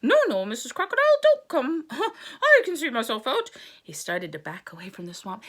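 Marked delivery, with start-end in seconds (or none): 0.0-3.5 high, loud mock British frog accent; a bit British. Frog excusing himself to the crocodile in mock British accent. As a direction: back away politely in a British accent. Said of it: "He sounded a little British there".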